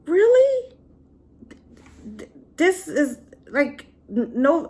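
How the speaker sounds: can't believe what she's seeing; incredulous. Real, not acted. Disbelief at husband's mother behavior.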